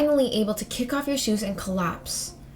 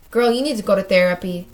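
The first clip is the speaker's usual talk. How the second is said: ordinary delivery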